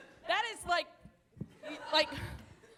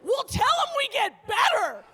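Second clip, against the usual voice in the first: mock triumphant idea; 3 semitones higher than usual. Old man's triumphant idea.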